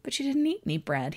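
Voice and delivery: confused. Acted. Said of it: "he said, confused".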